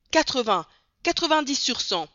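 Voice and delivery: plus fort et plus aigu; un voyageur surenchérit, emballé; enchère enthousiaste. A narrator's reading. Voyageurs enchérissent avec enthousiasme. (surenchère exaltée)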